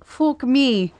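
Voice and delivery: short burst of put-on Scottish-Irish brogue; mock Irish or Scottish accent. Showing the host the typed words, mock Irish-Scottish accent. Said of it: "a thick Irish or Scottish accent".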